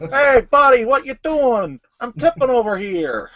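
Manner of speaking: high, hammed-up New York accent; mock American accent. Riffing on American tipping, high exaggerated New York accent. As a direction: call out like an indignant cartoon New Yorker, American accent.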